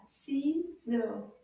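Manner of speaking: Ciseaux split as CI, pause, SEAUX; syllable by syllable. Shown as a demonstration. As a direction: separate the two beats of Ciseaux. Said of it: "Deux syllabes".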